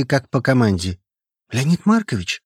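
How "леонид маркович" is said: ordinary delivery, nothing marked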